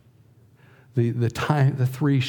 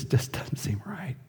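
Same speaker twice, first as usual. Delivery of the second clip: whisper; 5 semitones higher, 7 dB quieter than usual. Real, not acted. Grieving prayer at deathbed.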